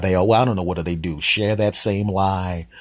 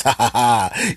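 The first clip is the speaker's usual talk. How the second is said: loud, higher mock cackle; gloating cat laugh; 5 semitones higher, 4 dB louder, slower than usual. Cat laughing as the squirrel gets caught, gloating.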